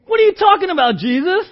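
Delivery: high, loud disbelief; can't believe what he hears; incredulous. Retold or imitated. Peter to Jesus, loud high disbelief. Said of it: "irony of what's happening here".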